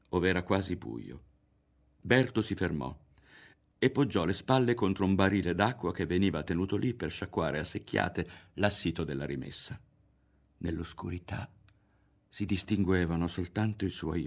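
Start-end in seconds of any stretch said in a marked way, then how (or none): none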